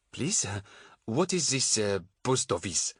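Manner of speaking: put-on halting French-accented English. A narrator's reading. Puzzled foreign man asking a woman, halting French accent. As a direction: fumble for words in a French accent. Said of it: "suddenly going very French".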